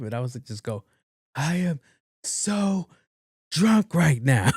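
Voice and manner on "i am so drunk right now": slow, drawn-out high holler; teasing call-out. Retold or imitated. Friend pranking the listening mom, loud mock drunken boast. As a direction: holler it slowly so the phone picks it up.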